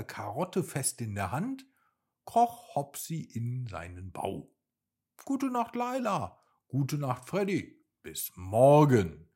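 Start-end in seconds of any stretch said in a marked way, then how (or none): 5.2-9.3 high, sing-song goodnight calls, loud at the end; cheerful goodnight. Acted. Rabbit Hopsi bids his friends goodnight, high sing-song voice. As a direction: call goodnight sweetly to each friend.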